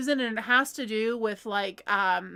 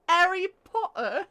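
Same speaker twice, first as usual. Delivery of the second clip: higher-pitched mock British, T dropped; British accent; 6 semitones higher than usual. Shown as a demonstration. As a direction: put on a playful British "Harry Potter". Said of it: "just drop the R's".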